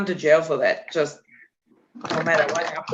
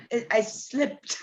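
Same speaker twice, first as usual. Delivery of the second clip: sheepish excuse; 4 semitones higher, 7 dB quieter, slower than usual. Retold or imitated. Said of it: "I'm like".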